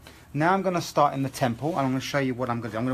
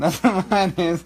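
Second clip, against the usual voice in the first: laughing; 6 semitones higher, 8 dB louder, slower than usual. Real, not acted. Joking about his dodgy accent.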